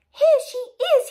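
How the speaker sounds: very high, loud cub squeal; loud tiny Baby Bear. Acted. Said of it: "tiny little voice as loud as he could".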